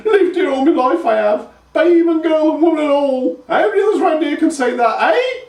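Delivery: high, loud hag voice, scornful; scolding old grandma. A narrator's reading. Grandma scolding Pike, voiced as a shrill old crone. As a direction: scold the idiot boy in a crone's cackle.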